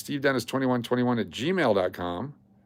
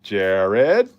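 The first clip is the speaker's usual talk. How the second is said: mock calling out; 2 semitones lower, 6 dB louder than usual. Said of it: "I'm just go".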